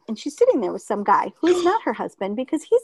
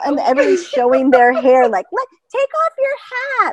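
grandma; 13 semitones higher, 5 dB louder than usual. Retold or imitated. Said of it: "It's like".

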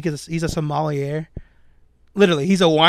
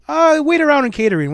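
dismissive boss voice; 8 semitones higher, 4 dB louder than usual. Retold or imitated. WWE brushing the partner off.

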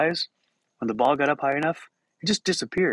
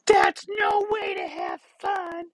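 high, slow, shouted Boston scolding; exaggerated Bostonian fan, shrieking in outrage; Bostonian accent; much slower than usual. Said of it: "the Bostonian fan in front of us turns around".

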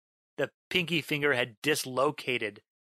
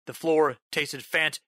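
ordinary delivery

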